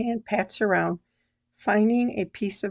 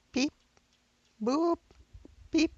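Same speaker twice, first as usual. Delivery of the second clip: dial tone beeps; 9 semitones higher than usual. Acted. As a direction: sing the beeps, high on boop. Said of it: "as I dial each number".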